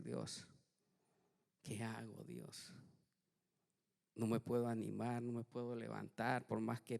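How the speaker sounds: soft, hushed plea to God; despairing prayer. Retold or imitated. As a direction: pray it quietly, defeated. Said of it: "le empecé a decir".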